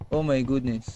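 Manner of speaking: normal talking voice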